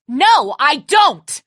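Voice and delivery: slow, loud, high refusal; angry refusal. Acted. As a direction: hammer each word in anger. Refusing to hand out food.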